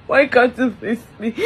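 usual speaking voice

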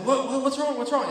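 high, loud, urgent questions; rattled, repeated asking; alarmed questioning. Retold or imitated. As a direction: act out startled concern at a screaming brother. Reacting to brother's screaming.

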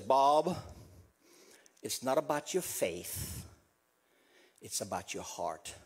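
low, hushed, spaced-out reassurance; tender retold advice, very quiet; gentle counsel. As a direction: sink low and soft, leaving room between phrases.

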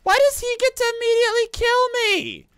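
high, loud screech of protest; outraged yelling. Real, not acted. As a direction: screech your outrage at an unfair death. Outraged at dying in game.

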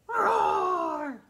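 loud, high mock roar; hammed-up dinosaur roar. Dinosaur bursts in.

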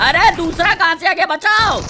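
very high-pitched cry for help; panicked cry. Pretend. Player calling teammates as second attacker appears, panicked cry. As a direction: yelp in alarm, pitch shooting up.

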